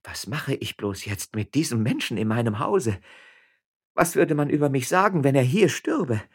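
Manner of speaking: agitated, high-pitched lament; wailing. A narrator's reading. Woman fears scandal over a dying man, agitated wailing. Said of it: "jammerte Frau Frasquita".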